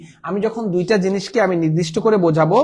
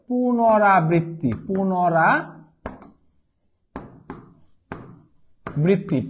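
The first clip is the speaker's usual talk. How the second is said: পুনরাবৃত্তি stretched out syllable by syllable; very slow drill; much slower than usual. Shown as a demonstration. Teacher drilling the word পুনরাবৃত্তি for students, very slow. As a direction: draw পুনরাবৃত্তি out very slowly so it sticks. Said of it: "repeating the meaning slowly".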